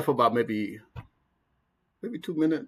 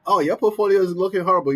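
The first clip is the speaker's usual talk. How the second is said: higher, louder, fast mocking voice; mocking an idiot; 6 semitones higher, 6 dB louder, faster than usual. Mimicking a commenter telling him to sell, mocking voice. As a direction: sneer it like a know-it-all critic. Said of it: "oh, some idiot tell me".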